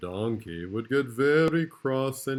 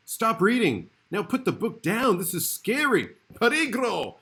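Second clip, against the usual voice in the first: much higher, louder warning to the reader; hammed-up panic, telling the reader to stop; a playful spooky alert to young listeners; mock alarm; 11 semitones higher, 5 dB louder than usual. A narrator's reading. Narrator warns reader, mock alarm.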